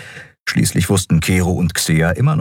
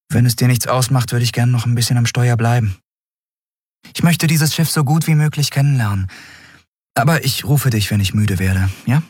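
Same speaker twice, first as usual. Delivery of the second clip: put-on lightly higher young captain, eager; a young woman keen to keep the helm; Endriel's voice; 6 semitones higher than usual. Captain asking her crewmate to let her stay, eager.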